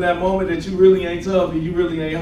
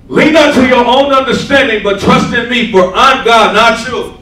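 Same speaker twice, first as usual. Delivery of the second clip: loud, commanding prophetic declaration; authoritative voice of God; 4 semitones higher, 8 dB louder than usual. Retold or imitated. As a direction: proclaim it like God speaking through her. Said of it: "speaking in that authoritative tongue".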